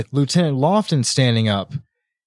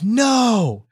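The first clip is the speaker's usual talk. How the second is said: anguished no; 11 semitones higher than usual. Real, not acted. Dismay at foreshadowed password.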